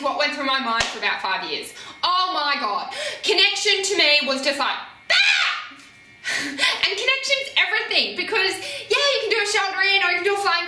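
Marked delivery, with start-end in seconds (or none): 5.1-5.7 much higher mock shriek of panic; a short hammed-up scream of overwhelm; panicked shriek. Connection felt like panic.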